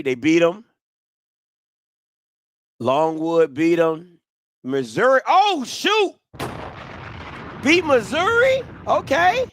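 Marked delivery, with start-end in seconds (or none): 5.3-9.5 pitched up in disbelief; surprised, way higher; surprised high oh shoot. Real, not acted. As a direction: react like you can't believe it, high. Surprised at schedule results.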